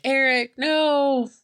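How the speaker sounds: high, drawn-out distressed cry; mock anguished call. Stacy calling out to stabbed Eric, mock anguished cry. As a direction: imitate calling out to him, slow and pleading. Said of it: "She's calling out for him".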